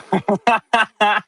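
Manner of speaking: bright high-pitched laugh; high giggle. Real, not acted. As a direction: laugh at your own joke. Laughing after full dance.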